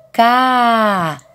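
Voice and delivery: K said as KAA, the A held long; drawn-out vowel. Shown as a demonstration. Teacher answering which vowel sounds, long held KA. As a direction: stretch out the A of the syllable KA. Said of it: "Som do A".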